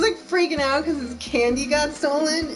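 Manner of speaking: ordinary talk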